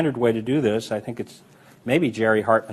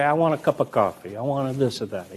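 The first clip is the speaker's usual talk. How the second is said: normal talking voice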